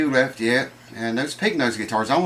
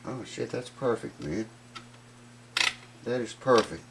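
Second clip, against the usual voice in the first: ordinary talk, nothing marked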